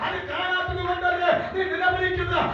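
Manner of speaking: high, driving revival preacher shout; impassioned pulpit proclamation in a sustained high voice; full-force preaching. Acted. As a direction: declare it to the congregation at full cry.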